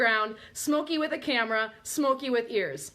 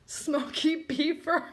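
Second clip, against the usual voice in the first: ordinary talk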